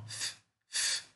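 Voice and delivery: voiceless F hissed through the teeth; a long unvoiced f sound. Shown as a demonstration. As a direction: push air out on "F, F" with no voice. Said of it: "F, que é esse som, ó".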